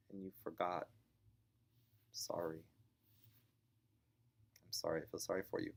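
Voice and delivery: soft, regretful sympathy with long pauses; kind, compassionate. Acted. Mom to boy who forgot the rule, softly sympathetic. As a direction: apologise gently, almost under your breath. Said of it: "responding to it with kindness".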